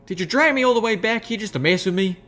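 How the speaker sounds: high annoyed country voice; annoyed country mare. Acted. Applejack accusing Rarity of teasing, high annoyed voice. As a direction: accuse her, pitched high.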